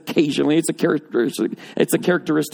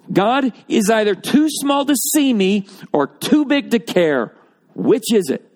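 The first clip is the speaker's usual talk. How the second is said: higher, louder, slower challenge to God; preaching challenge; 9 semitones higher, 3 dB louder, slower than usual. Acted. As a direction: throw the doubters' dilemma at the crowd. Preacher voicing doubters' complaint.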